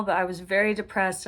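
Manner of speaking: ordinary delivery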